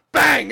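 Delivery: loud, high spoken bang; sudden explosive sound effect; imitated gunshot. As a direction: punch it out like a gunshot. Acts out the gunshot.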